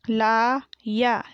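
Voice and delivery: लाया split into long laa and yaa; very slow लाया, syllables held apart; spelled out. Shown as a demonstration. Teacher sounding out लाया for pupils, syllable by syllable. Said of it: "मैंने इस तरीके से पढ़ा".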